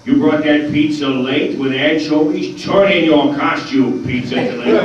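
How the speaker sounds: loud, high, mock indignant showman; bad Stan impression, loud and exclaiming; Stan Lee impression. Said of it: "we all have our bad Stan impressions".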